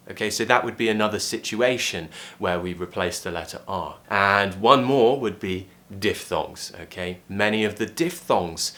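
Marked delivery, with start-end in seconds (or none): none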